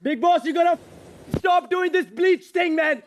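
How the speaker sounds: high-pitched, outraged appeal; agitated protest. Real, not acted. Housemate appealing to Bigg Boss against bleach, high and agitated.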